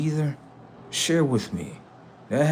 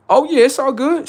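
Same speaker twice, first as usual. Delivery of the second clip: mock breezy high; 7 dB louder than usual. Mock carefree voice.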